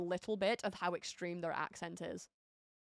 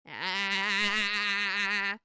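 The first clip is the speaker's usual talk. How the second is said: long, vibrating monotone "aaah"; imitated drone "ahh"; 4 dB louder than usual. Streamer repeating the imagined emo sound, drawn-out drone. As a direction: hold one droning vowel, imitating a noise.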